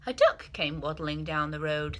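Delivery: ordinary delivery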